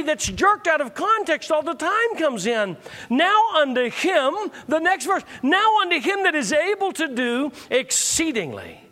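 high-pitched sermon build on scripture; forceful preaching. Acted.

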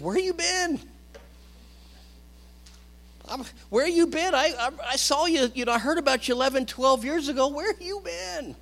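put-on high, excited voice of an old friend finding Paul; surprised Barnabas. Barnabas calling out to long-lost Paul, high and amazed. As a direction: pitch it way up, delighted and astonished.

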